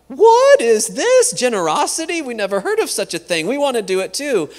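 high, astonished outcry; amazed students. Retold or imitated. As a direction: voice the students' wonder, rising high.

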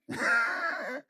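short high-pitched laugh; brief laugh, well above the speaking voice. Real, not acted. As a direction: let out a quick, high laugh. Amused retelling of partner's reply.

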